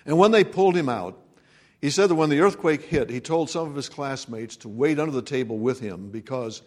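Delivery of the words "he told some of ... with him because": ordinary delivery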